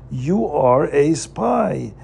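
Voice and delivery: ordinary delivery, nothing marked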